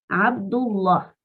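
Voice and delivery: Abdullah slow with a thick lam; heavy lam. Shown as a demonstration. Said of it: "Estoy diciendo Allah fuerte".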